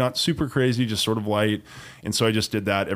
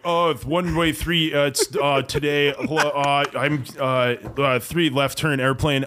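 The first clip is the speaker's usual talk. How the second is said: higher, slow, stumbling radio call full of uhs; stage-fright rookie radio call; 4 semitones higher, slower than usual. Retold or imitated. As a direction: fumble it like a nervous student pilot. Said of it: "you're stage fright".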